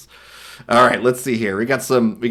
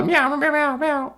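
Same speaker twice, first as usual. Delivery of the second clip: cat meows; 12 semitones higher, 4 dB quieter than usual. Retold or imitated. Imitating cats in the scene.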